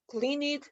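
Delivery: "cleaned" tried out several ways, as clin, clint and clinet; testing out "cleaned" in different versions, one after another; trying pronunciations. Shown as a demonstration. Teacher trying out versions of "cleaned" for students, testing each one. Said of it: "¿cómo lo voy a pronunciar?".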